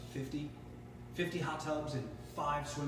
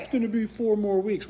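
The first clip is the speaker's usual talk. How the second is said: higher and louder, mock-official; mock dealer voice; 7 semitones higher, 11 dB louder than usual. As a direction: play the annoying dealer with a smug tone. Mocking dealer delay call.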